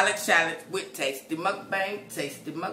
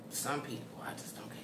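whispered, half to herself; whispered aside; 3 semitones lower, 14 dB quieter than usual. Real, not acted. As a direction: drop to a whisper. Repeated line whispered.

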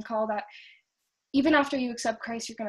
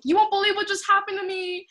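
high and louder, bursting with news; excited; 8 semitones higher, 4 dB louder than usual. Retold or imitated. Calling her friend after the miracle, breathless excitement. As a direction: gush like you can't hold it in.

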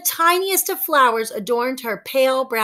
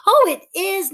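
beaming; 8 semitones higher, 5 dB louder than usual. A narrator's reading. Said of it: "Nixie beamed".

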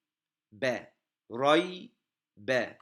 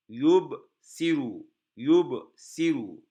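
emphatic slow reading; 6 dB louder, much slower than usual. Shown as a demonstration. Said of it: "model reading, loud, slow".

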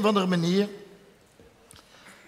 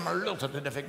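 a much softer, hushed plea; 5 semitones lower, 9 dB quieter than usual. Retold or imitated. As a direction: keep it down so the guests can't hear. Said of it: "ik kon niet te hard praten".

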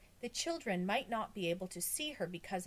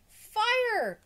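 very high, loud cry; yelled alarm; 11 dB louder than usual. A narrator's reading. Boy corrects his alarm on the shaking roof, high yell. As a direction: yell out the emergency.

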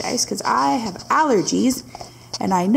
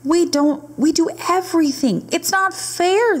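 whiny complaint rising higher and higher; crying out unfairness; 9 semitones higher than usual. Acted. Animals complaining to the magic watcher, rising whiny protest. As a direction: plead like wronged little animals. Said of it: "they cried".

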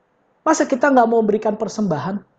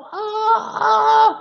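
choked gasps; 8 semitones higher than usual. Retold or imitated. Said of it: "Sampai dia udah nyesek".

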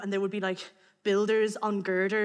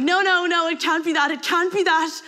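loud, high panicked no no no; frantic self-denial; 6 semitones higher, 6 dB louder than usual. Retold or imitated. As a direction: deny it in rising panic. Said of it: "And I was like".

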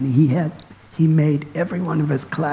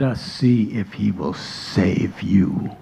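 menacing whisper; 7 semitones lower than usual. Acted. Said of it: "He said".

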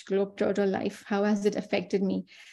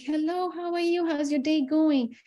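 an American grocery cashier greeting a customer, much higher and bubbly; cheery cashier; 7 semitones higher than usual. Retold or imitated. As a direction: greet a stranger like a chirpy American store clerk. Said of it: "they'll be like".

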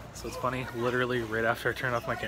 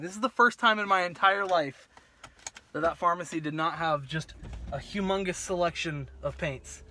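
normal talking voice

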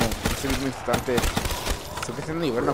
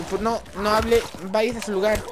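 teacher scolding; 8 semitones higher, 4 dB louder than usual. Retold or imitated. Teacher voiced in school story.

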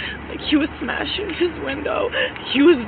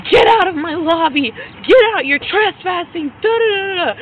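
high, loud mock yell of an irate employee; imitated yelling; 5 semitones higher, 6 dB louder than usual. Woman imitating employee ordering her out of the lobby, mock yelling. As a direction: yell it mockingly, trailing into nonsense syllables. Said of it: "And he was yelling".

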